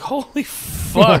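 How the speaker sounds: slow, high, stretched-out amazement; awed exclamation. Retold or imitated. Nephew seeing the shot stump, awed exclamation. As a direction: draw it out, acting stunned.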